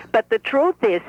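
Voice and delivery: put-on lively old Brooklyn bubby; Bubby's voice. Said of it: "This is the Bobby talking".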